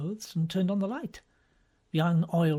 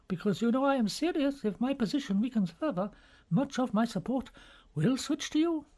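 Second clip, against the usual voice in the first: put-on higher, anxious elderly churchman fretting aloud; fretful cardinal voice; 7 semitones higher than usual. A narrator's reading. Bellini fretting to Lomelli, higher anxious voice. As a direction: cardinal confiding his worries to his colleague, higher and fretful.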